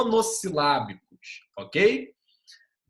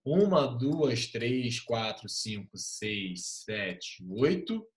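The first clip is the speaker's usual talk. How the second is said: very slow count, dropping quieter to a whisper-like low; counting syllables; 5 semitones lower, 5 dB quieter, much slower than usual. Shown as a demonstration. Teacher scanning a verse aloud, slow counting. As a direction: tick off each syllable, slow and soft. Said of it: "Cinco sílabas poéticas".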